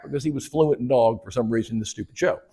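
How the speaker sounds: ordinary talk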